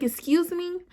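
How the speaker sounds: high-pitched, taken-aback question; offended disbelief. Retold or imitated. Woman retelling her comeback to boyfriend, offended. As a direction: say it like you can't believe your ears.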